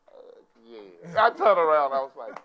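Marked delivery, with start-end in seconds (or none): 0.1-1.2 pitched-up, drawn-out mock yes; inner reply. Comic answering himself in his mind, relishing it. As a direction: let it rise like a private comic verdict. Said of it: "in my mind I went".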